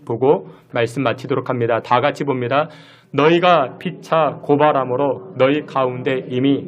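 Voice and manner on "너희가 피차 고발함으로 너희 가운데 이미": slow, measured Bible reading; scripture reading. Acted. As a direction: read the verse slowly and solemnly. Reads scripture aloud.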